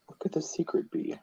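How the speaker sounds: hushed, breathless whisper. Real, not acted. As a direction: whisper it like a secret. Teasing the mystery.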